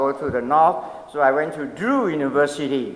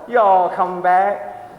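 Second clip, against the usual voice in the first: slow, loud Southern drawl; imitation Southern drawl, long and slow; 2 semitones higher, 5 dB louder, much slower than usual. Shown as a demonstration. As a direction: stretch it out like a Southerner. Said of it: "that's the Southern drawl".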